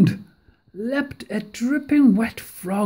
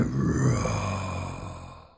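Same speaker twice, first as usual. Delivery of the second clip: scary roar; 9 dB quieter than usual. A narrator's reading. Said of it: "scary and loud".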